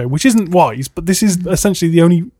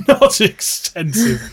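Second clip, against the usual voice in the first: drawn-out, higher mock echo; teasing mimicry; 5 semitones higher, much slower than usual. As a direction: parrot it back slowly, pitched up. Mimics co-host's phrase back, teasing.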